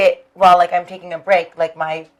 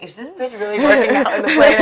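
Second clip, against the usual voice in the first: higher, laughing judgy passenger; 6 semitones higher than usual. Retold or imitated. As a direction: sneer at her workout. Voicing judging plane passengers.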